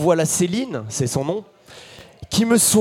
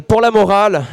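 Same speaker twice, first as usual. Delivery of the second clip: ordinary delivery, nothing marked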